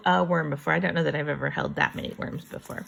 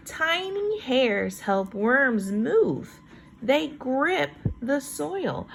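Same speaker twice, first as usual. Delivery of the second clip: higher, slow storybook read; reading to children; 7 semitones higher, much slower than usual. Acted. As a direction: lift the voice and read slowly for little ones. Reads book text, higher.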